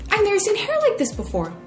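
high-pitched gushing astonishment; mock amazement. Acts out an amazed stranger.

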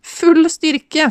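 high, mock-echoing titter; giggly. A narrator's reading. Tipsy woman echoing his popcorn instructions, giggly. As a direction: echo it high, with a touch of giggle. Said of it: "fniste Ellen".

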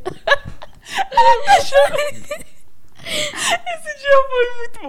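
chorando de rir, lento e agudo; laughing through tears; crying with laughter. Real, not acted. Laughing so hard she cries. (chorando de rir)

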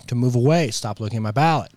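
ordinary talk

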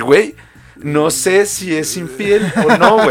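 slow, high, dithering voice; drawn-out unsure shrug; mock clueless partner. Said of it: "y decir".

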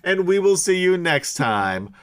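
slow, loud announcer sign-off; show sign-off. Acted. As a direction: draw out the farewell like a host.

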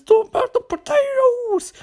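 squeaky, very high mock Irish; botched Irish accent. Podcaster mocking the potato-loving hotel man, squeaky bad Irish accent. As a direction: shoot up into a silly Irish squeal. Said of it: "what fucking accent I was aiming for".

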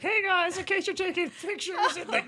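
high squeaky imitation; mock ditzy voice. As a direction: imitate her, high and giddy. Zoe's silly group message quoted.

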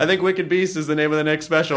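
ordinary delivery

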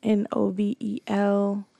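slow, letter-by-letter spelling; measured pace, each letter separate; spelled out. Real, not acted. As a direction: spell it carefully for listeners. Spelling out her website slowly.

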